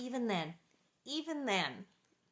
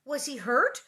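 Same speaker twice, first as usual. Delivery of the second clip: higher, louder worried question; anxious; 5 semitones higher, 6 dB louder than usual. A narrator's reading. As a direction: ask with a touch of alarm. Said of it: "Mary asked anxiously".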